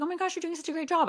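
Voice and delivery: mock cheerful praise. Affirming a frustrated friend with her gift bag, high gushing praise. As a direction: gush over her work to cheer her up.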